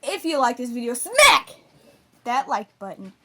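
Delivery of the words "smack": high loud punch on smack. Acted. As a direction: slam the word smack. Emphatic outro.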